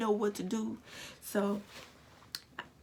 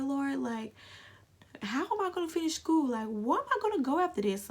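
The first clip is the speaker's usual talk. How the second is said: desperate plea; 5 semitones higher than usual. Retold or imitated. Retold desperate prayer while crying.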